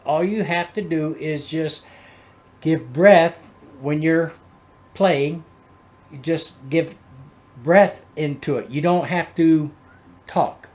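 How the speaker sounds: ordinary delivery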